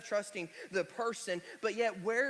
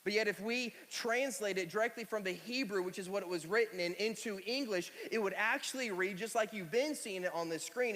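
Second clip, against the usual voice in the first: usual speaking voice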